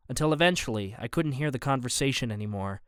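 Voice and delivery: ordinary delivery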